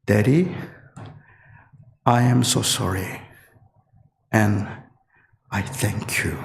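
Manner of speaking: mild imitation of a boy apologizing through tears; tearful apology. Son in the back seat apologizing to his father, mildly tearful. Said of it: "눈물을 흘리면서".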